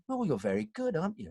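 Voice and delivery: mock-impressed casting voice; gushing interviewer. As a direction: coo approval in a high voice. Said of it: "they'd go".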